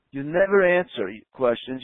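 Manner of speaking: ordinary talk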